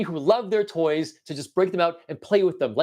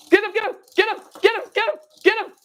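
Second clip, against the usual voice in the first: very high squeaky cheering; excited egging-on; 4 dB louder, faster than usual. Pretend. Toy player urging fish man to attack, squealing excitedly. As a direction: urge him on in rapid high squeals.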